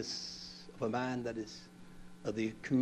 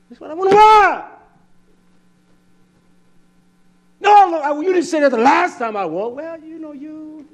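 much higher, loud indignant wife; mock wife outburst; 13 semitones higher, 16 dB louder than usual. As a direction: squawk it like the outraged wife. Wife protests loudly.

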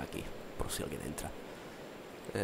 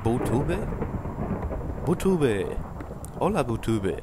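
calling out; 4 semitones higher, 4 dB louder, slower than usual. Real, not acted. Calling out to a friend.

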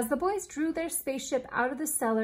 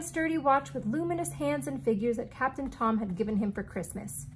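ordinary delivery, nothing marked

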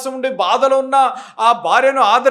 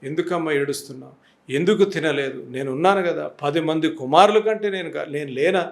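lower, softer tender reassurance; gentle, consoling; 5 semitones lower, 3 dB quieter than usual. Shown as a demonstration. Preacher voices Elkanah comforting his crying wife, gently. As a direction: soothe her as a caring husband. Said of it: "నెమ్మదిగా".

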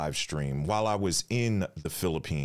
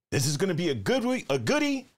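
excited; 11 semitones higher, 3 dB louder than usual. Real, not acted. Host hyping the week ahead.

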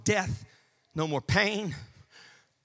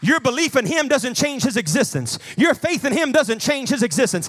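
loud, driving sermon building higher; full-force preaching; 9 semitones higher, 5 dB louder than usual. Real, not acted. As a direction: preach it in punched, rising declarations.

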